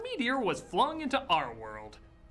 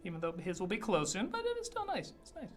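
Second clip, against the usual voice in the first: high, bright mock-cheerful sarcasm; sing-song sarcastic praise; 8 semitones higher than usual. Sarcastic cheerful stroll commentary.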